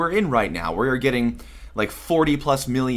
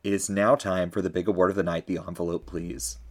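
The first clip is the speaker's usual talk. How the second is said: low, hushed, dropping to quiet; suspenseful awards presenter; 5 semitones lower, 4 dB quieter than usual. Acted. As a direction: hold the room before the envelope. Builds suspense before the winner.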